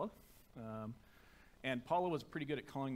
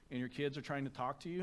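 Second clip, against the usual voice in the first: ordinary talk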